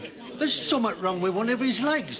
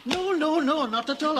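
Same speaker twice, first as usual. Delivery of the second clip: ordinary talk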